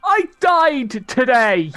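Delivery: loud laughing yell, slow; yell at the storm. Acted. Player yelling at the storm after dying today. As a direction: shout it up at the sky, half laughing. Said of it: "I yell back at the storm".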